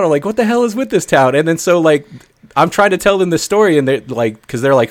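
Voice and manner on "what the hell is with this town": high exasperated disbelief; freaking out. Retold or imitated. Storyteller replaying his reaction to Boston, freaking out. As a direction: protest at a crazy town, pitched up. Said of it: "I'm, like, freaking out".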